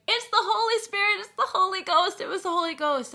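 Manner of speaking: the chat reacting to the streamer in tears, high and loud; excited chat. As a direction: shout it like an ecstatic crowd. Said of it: "the chat's going crazy, going".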